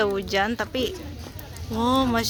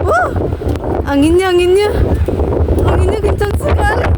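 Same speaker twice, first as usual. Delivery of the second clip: shouting over wind; 9 semitones higher, 9 dB louder than usual. Real, not acted. Wind gusts, voice climbs.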